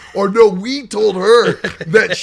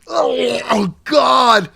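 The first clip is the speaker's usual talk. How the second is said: real gagging disgust; 5 semitones higher, much slower than usual. Real, not acted. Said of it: "I'm gagging".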